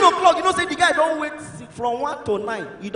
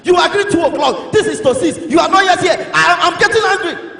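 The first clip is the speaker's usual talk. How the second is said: hammed-up angry shouting, higher and louder; provoked boyfriend; 4 semitones higher, 7 dB louder than usual. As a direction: rant loudly at the late girlfriend. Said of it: "they provoke".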